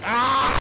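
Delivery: short, high, loud yelp; sudden scream of alarm; startled scream. Acted. Driver spots a bee in the car, startled scream.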